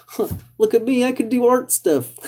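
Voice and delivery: high, silly self-mocking brag; mock self-satisfied. Mocking his own crafts to viewers, a high silly brag. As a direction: puff up like a kid proud of a craft. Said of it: "And I'm just like".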